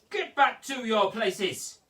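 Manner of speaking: a slow, loud, stern teacher's call; teacher shouting to his class, firm and loud. Acted. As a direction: bark it slowly across the classroom. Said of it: "before he shouted".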